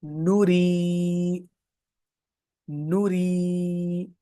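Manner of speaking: नूरी read slowly with the long ू and ी held out; stretched reading. Shown as a demonstration. Teacher reading a word aloud to students, vowels stretched. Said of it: "stretch करते हुए".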